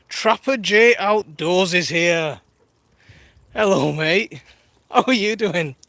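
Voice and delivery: loud, drawn-out welcome announcement; hearty greeting. Real, not acted. Streamer greets Trapper J joining the live, hearty and loud. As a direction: hail a newly arrived friend loudly.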